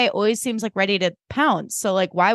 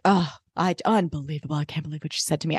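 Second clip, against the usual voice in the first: mock self-pity; 5 semitones lower, 5 dB quieter, faster than usual. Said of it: "Lou's like".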